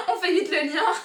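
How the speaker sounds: said through a high laugh; giggling at how obvious it is. Real, not acted. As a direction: laugh the words out, amused. Laughing at obvious romance pairing.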